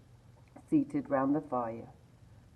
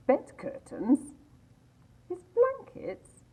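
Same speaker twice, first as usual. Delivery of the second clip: a lightly voiced old woman, slow and higher; charwoman voice; 4 dB louder, slower than usual. A narrator's reading. Old woman haggling at the rag shop, mocking. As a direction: sneer over the stolen bed curtains.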